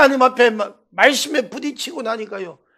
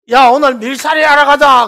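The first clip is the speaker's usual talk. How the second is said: put-on loud, higher call to the other kids; rally the boys with a shout; calling the gang; 5 semitones higher, 10 dB louder than usual. Gang leader calling the boys to go.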